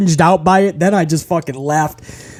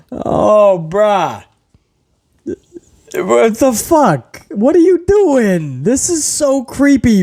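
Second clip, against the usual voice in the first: slow, higher disbelief; creeped out; 4 semitones higher, much slower than usual. Real, not acted. Reacting to Riddle's promo, slow creeped-out disbelief. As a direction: recoil in disbelief.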